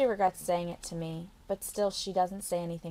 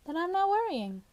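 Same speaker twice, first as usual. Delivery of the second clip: much higher, light reassuring Carla; lightly voiced Carla; 11 semitones higher than usual. Carla reassuring the girl, much higher. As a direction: let the worry go kindly.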